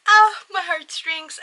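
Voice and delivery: high, loud, drawn-out swoon; touched gushing. Real, not acted. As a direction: gush as if your heart melts. Gushing over a touching moment.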